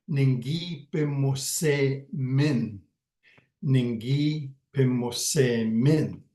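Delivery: "Ningi pimose min" said slowly, every syllable clear; careful slow demo. Shown as a demonstration. Teacher modeling "we walked" for learners, slow and careful. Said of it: "teaching the word".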